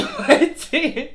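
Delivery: loud, high word bursting into laughter; laughing. Real, not acted. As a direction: crack up on the repeated word. Breaks into laughter while repeating.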